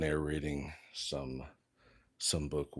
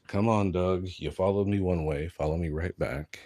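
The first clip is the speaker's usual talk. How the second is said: calling out; 3 semitones higher, 12 dB louder than usual. Real, not acted. Calling the dog back.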